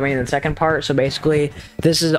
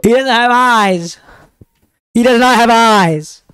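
shocked yelling; 7 semitones higher, 8 dB louder than usual. Real, not acted. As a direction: shout it out in disbelief. Shocked at monster.

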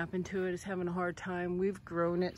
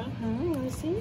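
pet talk; 8 semitones higher than usual. Real, not acted. Talking to dog, high pitch.

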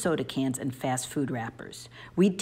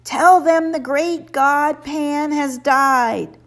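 slow, high, loud distant call; a voice from the shore calling to a sailor; mysterious crying voice; 11 semitones higher, 6 dB louder, much slower than usual. A narrator's reading. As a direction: cry it across the water like an omen. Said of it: "a mysterious voice crying out".

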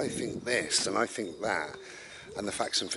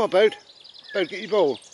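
calling the dog; 10 semitones higher, 10 dB louder than usual. Real, not acted. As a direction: call out loudly and brightly to the dog.

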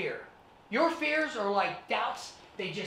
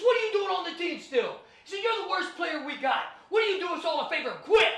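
mock bully yell; 10 semitones higher than usual. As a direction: sneer and bark like the school bully. Bully Mark taunting on field.

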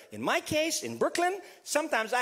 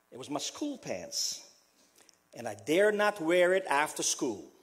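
usual speaking voice

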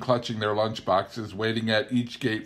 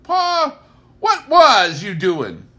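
put-on very high, loud scolding old woman; Ma demanding of Pa, high and loud; Ma Kettle voice; 13 semitones higher, 11 dB louder, slower than usual. A narrator's reading. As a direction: scold him from the porch.

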